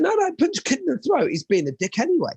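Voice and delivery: high-pitched mock reassurance, easing down; insistent protest. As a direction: double down on outrageous advice. Retold pitch to shocked parents.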